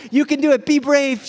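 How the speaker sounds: loud, high imitation of mom cheering; rally the child like an eager mom; encouraging mom. Wife urging small son to be brave, encouraging.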